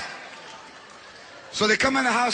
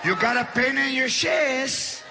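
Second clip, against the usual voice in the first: sarcastic aunt; 3 semitones higher, 4 dB quieter, slower than usual. Retold or imitated. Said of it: "now she's like".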